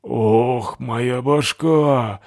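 slow, groaning complaint. Acted. Натаха стонет, поднимаясь с пола, slow groan. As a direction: come to with an aching head. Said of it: "застонала".

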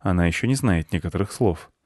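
ordinary delivery

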